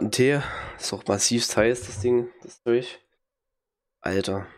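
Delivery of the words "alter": ordinary delivery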